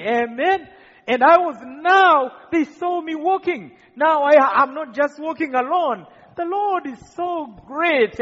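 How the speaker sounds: a preacher's joyful climax; fiery preaching. Acted. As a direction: lift the crowd with rising fervour. Preaching at full force.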